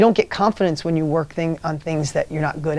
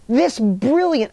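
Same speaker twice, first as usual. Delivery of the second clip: ordinary delivery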